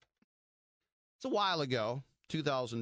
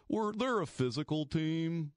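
mocking coach cliché; 5 semitones higher than usual. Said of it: "our little verbiage".

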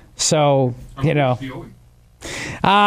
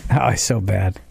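ordinary talk

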